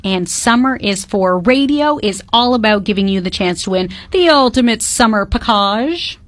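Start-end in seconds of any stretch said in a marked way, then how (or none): 5.2-6.2 "package" said fancy, like pa-KAHZH; sophisticated pronunciation. Real, not acted. Radio host showing off to co-host, "package" pronounced fancily. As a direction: put a posh French-style ending on "package". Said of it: "the package thing".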